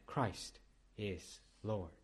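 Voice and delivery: very slow, soft solemn confession. Retold or imitated. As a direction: say it slowly like a first vow. Confessing faith for first time.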